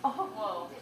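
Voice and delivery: usual speaking voice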